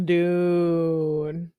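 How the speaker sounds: long, rising, disbelieving dude; drawn-out dude. Real, not acted. To friends, hearing the texts, a long rising dude. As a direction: stretch out Dude like you can't believe it.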